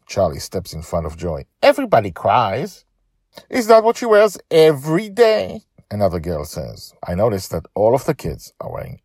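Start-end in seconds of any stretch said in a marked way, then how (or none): 1.6-2.8 put-on higher, louder kid's retort; child voice. Charlie defends Joy. 3.5-5.6 put-on high, loud sneering child; mean girl. Girl mocks Joy's clothes.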